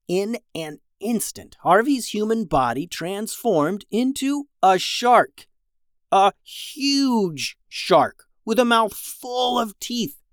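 high, drawn-out storyteller suspense; slow, wide-eyed narration with soaring pitch; dramatic reveal. A narrator's reading. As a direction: build the big transformation, stretching each word. Dramatic telling of shark transformation.

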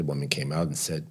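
ordinary delivery

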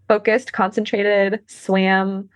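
normal talking voice

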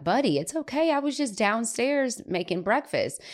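high-pitched, gentle reassurance; soothing mother. Retold or imitated. Mom hugs Eli after the scare, soothing.

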